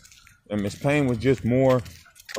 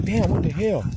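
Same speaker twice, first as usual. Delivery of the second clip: higher pitched, upset exclamation; mad outburst; 8 semitones higher than usual. Retold or imitated. Coming home to his hurt dogs, retold angry outburst. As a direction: let the anger spill out. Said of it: "I'm just like".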